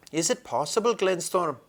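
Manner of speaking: doubtful old badger. A narrator's reading. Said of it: "said the badger".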